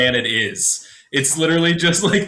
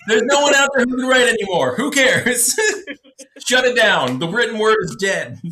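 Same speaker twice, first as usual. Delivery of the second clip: mock dramatic despair; 8 semitones higher, 4 dB louder than usual. Mock dramatic rant, laughing.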